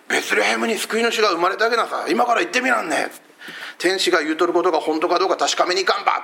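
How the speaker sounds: a country shepherd urging his friends on in broad dialect. Pretend. A shepherd urges the others toward Bethlehem in Hakata dialect. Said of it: "方言が入ってるとすごく身近だろうな".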